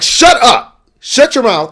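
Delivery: high, loud fed-up snap; annoyed outburst. Retold or imitated. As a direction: snap back to silence the whooping. Annoyed retort to the whooping host.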